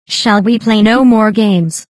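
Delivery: normal talking voice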